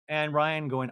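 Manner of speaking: normal talking voice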